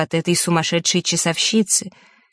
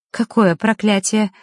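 quick, higher-pitched question; quick query; 4 semitones higher than usual. A narrator's reading. Vasilisa instantly asking about the curse, quick query. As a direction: jump in at once with the question. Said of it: "мигом переспросила Василиса".

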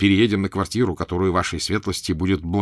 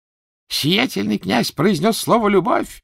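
much higher, slow, loud exclamation; poet shouting; 13 semitones higher, 5 dB louder than usual. A narrator's reading. The poet calling to the prince over the petitioners, high shout. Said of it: "закричал стихотворец".

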